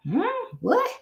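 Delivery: high, puzzled "Hmm, what?" as if confused; a listener lost and confused; baffled hmm. Retold or imitated. Confused audience member asking what.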